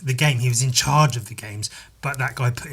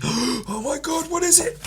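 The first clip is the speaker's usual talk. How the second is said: shocked gasp; 14 semitones higher, 3 dB quieter than usual. Retold or imitated. Translator sees beast.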